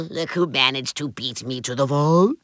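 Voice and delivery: low, soft villain taunt; sly sneer. Acted. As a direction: drop it low and soft, mock-impressed. Villain sneers at his rival.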